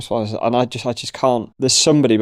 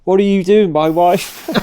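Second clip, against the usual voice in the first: loud, higher mock outrage; mock angry husband; 7 semitones higher, 5 dB louder, slower than usual. Jealous husband shouts at him for talking to his wife.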